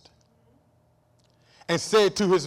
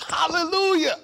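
hallelujah cry; 12 semitones higher, 5 dB louder than usual. Real, not acted. Preacher's exclamation.

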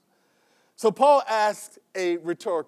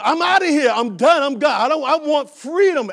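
excited freed slave; 6 semitones higher, 8 dB louder than usual. Retold or imitated. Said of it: "they said".

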